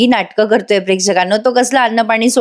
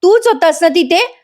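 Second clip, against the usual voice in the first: high-pitched mock yell, stammering; hammed-up outcry tripping over the words; acted shouting; 8 semitones higher than usual. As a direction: accuse him in a worked-up, much higher voice. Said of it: "मोठ्याने सुमन ओरडते".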